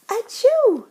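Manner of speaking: big high acted achoo; loud sneeze. Bear sneezes.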